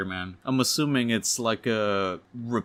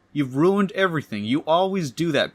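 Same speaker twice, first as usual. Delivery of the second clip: higher-pitched, mildly hammed-up blame; upset accusation; 5 semitones higher, 4 dB louder than usual. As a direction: lay the blame on them, exasperated. Acted quarrel, blaming Peter.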